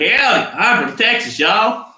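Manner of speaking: high, loud mock Texas twang; terrible Southern accent. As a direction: proclaim it in a hammed-up fake Texan accent. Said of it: "I've got a terrible Southern accent".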